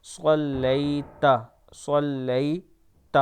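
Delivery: صلیت with a thick ص, slow, ending in تا; slowly, heavy ص in صلیت; slow recitation with heavy ص. Shown as a demonstration. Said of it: "موٹا پڑھنا ہے تھوڑا سا".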